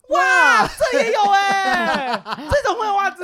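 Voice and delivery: very high, loud, stretched-out mock shout with laughter; over-the-top shock at socks under the sofa; mock astonishment. Lifting the sofa cover in front of the partner, mock shouting in astonishment. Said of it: "然后我就把沙发那个布掀起来说".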